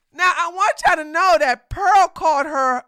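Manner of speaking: ordinary talk, nothing marked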